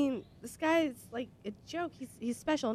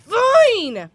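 snapping; 10 semitones higher, 12 dB louder than usual. Real, not acted. Snapping at co-host.

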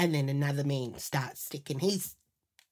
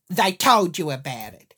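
loud, disbelieving question; incredulous echo; 10 dB louder than usual. Retold or imitated. Husband doubting his wife's excuse, in a loud incredulous echo. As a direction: throw it back at full voice, doubting.

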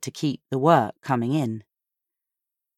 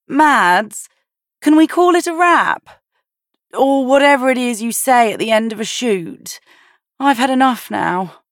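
high, loud call across the garden; bellowing; 10 semitones higher, 6 dB louder than usual. A narrator's reading. Sophia calling to her sister to end the shoot, loud and high. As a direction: shout it out, fed up. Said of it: "Sophia bellowed".

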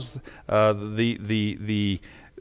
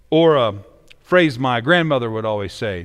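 ordinary talk

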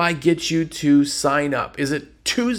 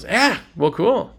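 careless salesman; 7 semitones higher, 5 dB louder, faster than usual. Acted. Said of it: "the car salesman goes".

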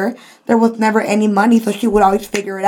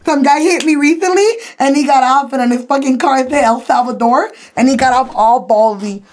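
ordinary talk

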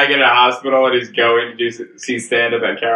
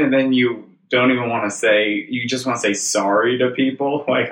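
usual speaking voice

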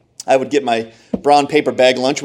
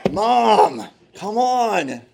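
whiny protest; 9 semitones higher, much slower than usual. Retold or imitated. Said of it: "I'm like".

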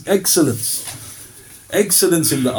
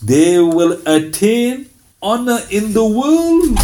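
normal talking voice

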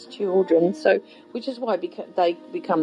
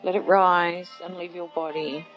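guided meditation. Acted. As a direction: gently lead them inward. Leads a guided visualization.

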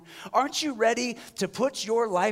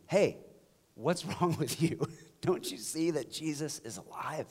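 softer, gently amused invitation; nice, gentle take; 2 semitones lower, 6 dB quieter than usual. Shown as a demonstration. Pastor showing how to greet people nicely, soft and gentle. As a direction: ask kindly with a little laugh. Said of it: "addressing people in our life nicely".

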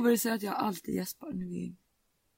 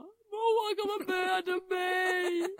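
imitating a much higher, drawn-out wail; whiny panic; 11 semitones higher, much slower than usual. Sister phoning mom about her brother, whining in fake fear. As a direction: stretch out the plea, mock terror. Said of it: "hon bara ringer mamma och bara".

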